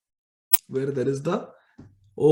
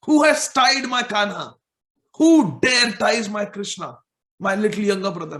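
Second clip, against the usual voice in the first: higher, louder protective outrage; angry Balram; 5 semitones higher, 4 dB louder than usual. Acted. Balram demands who tied his little brother, higher and louder. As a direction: demand it like an indignant big brother. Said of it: "how angry I had become as Balram".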